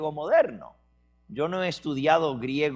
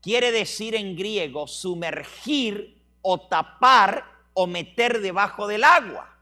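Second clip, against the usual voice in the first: insistent tour guide; 4 semitones higher, 5 dB louder than usual. Retold or imitated. Preacher voicing Greek tour guide.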